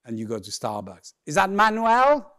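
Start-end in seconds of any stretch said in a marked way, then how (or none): 1.3-2.3 loud, high questioning barista; querying barista. Acted. Starbucks barista asks for his name, loud high query. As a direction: call out the question, pitched up.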